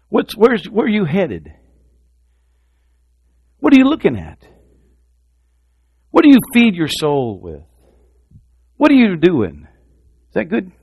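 high, loud challenging questions; fervent challenge. Acted. Preacher challenging listeners about their focus, loud. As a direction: fire the questions at them hard.

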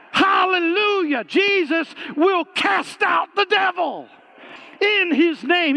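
slow, high, loud preacher's cry; full force preaching. Acted. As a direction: thunder it out from the pulpit.

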